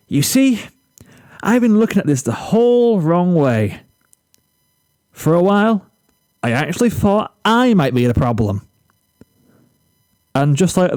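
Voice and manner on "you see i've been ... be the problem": put-on a confident, higher-pitched boy sure of himself; self-assured. A narrator's reading. Zephyr to Flash, proudly sharing his great insight, self-assured. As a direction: announce it like a grand revelation. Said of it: "the most self-assured tone".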